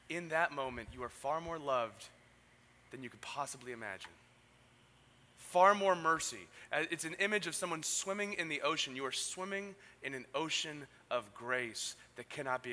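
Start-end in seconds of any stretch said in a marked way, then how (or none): 5.4-6.5 loud, slow proclamation; emphatic preaching. Acted. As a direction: declare it slowly and loud. Preaching climax.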